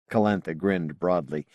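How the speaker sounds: plain reading voice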